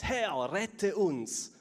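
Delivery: frightened disciples calling Jesus awake, urgent; excited cry for help. Acted. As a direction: call out to the Lord in alarm. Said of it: "riefen sie aufgeregt".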